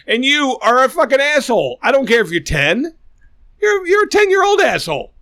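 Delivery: real anger, voice climbing; angry rant. Real, not acted. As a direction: let the anger boil over at him.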